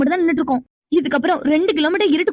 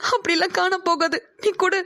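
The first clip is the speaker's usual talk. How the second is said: crying; 4 dB quieter than usual. Acted. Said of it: "நீ அழாத".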